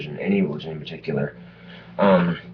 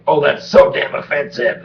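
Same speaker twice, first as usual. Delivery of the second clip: offended prude voice; 8 semitones higher, 7 dB louder than usual. Retold or imitated. Mocking offended onlookers.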